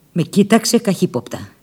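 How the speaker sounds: ordinary delivery, nothing marked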